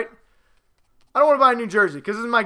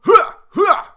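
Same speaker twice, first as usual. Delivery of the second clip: shouted high war cry; loud gung-ho soldier; 12 semitones higher, 10 dB louder than usual. Real, not acted. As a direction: bark it like a drill soldier. Victory battle cry.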